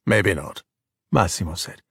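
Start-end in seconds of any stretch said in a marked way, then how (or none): 0.1-0.6 low-pitched, understated reply; a hint of a deep-voiced man; lightly voiced father. Massimo answers nervous Luca, lightly low.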